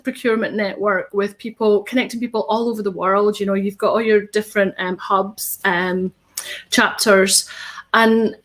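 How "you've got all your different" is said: ordinary talk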